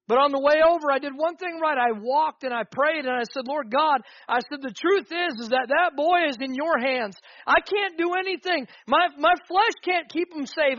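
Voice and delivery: loud, high impassioned preaching; full-force preaching. Real, not acted.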